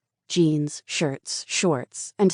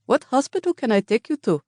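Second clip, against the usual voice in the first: put-on higher, concerned driver with an accent; worried cab driver; 9 semitones higher, 4 dB louder than usual. A narrator's reading. Cab driver alarmed at her injuries. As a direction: ask urgently, alarmed at her face.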